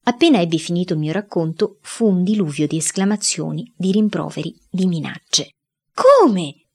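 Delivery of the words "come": un Come? gridato, acuto e forte; grido. A narrator's reading. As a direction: gridalo indignata. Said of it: "gridava la mamma". (la mamma grida al figlio, indignata)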